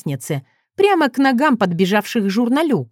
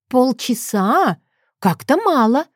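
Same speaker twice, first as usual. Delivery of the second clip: drawn-out, higher-pitched doubt; coy haggling; 5 semitones higher, slower than usual. A narrator's reading. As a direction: stretch it out as if unimpressed. Торгуется, качает головой. (протяжно, с сомнением)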